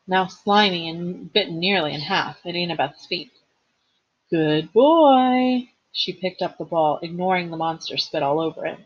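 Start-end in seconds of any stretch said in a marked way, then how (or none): 4.3-5.7 loud, high praise to a pet; dog praise. A narrator's reading. Girl rewarding the dog for dropping the ball, loud high praise. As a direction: praise the dog, raised and ringing.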